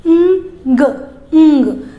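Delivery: "ंग" half n half g, nasal and held; ng sound. Shown as a demonstration. Teacher drilling ng for parents. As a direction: blend "ंग" with no full g at the end. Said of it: "आधा न और आधा ग".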